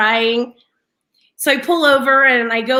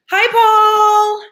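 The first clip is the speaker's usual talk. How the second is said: loud, high sung-out hello; bright holler hello, pitched up; calling out a greeting; 7 semitones higher, 6 dB louder than usual. Real, not acted. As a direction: call out to someone passing. Calls greeting to passerby.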